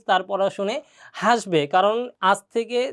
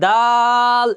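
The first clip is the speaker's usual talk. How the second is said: দাল stretched long and louder; drawn-out demo; 6 dB louder, much slower than usual. Shown as a demonstration. Teacher stretches দাল so students hear the pull. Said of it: "এখানে একটা টান দিয়েছি".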